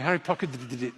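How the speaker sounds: "pocketed it" with stammered extra d-d syllables; failed attempt, stuttering the end of "pocketed it"; tongue-tied stumble. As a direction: re-enact tripping over "pocketed it" into did-did-dit. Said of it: "I can't say Harry pocketed it".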